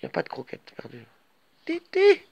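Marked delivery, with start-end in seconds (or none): 1.7-2.2 high loud call of a name; loud name call across the room. Real, not acted. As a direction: shout the cat's name to stop it. Calling the cat.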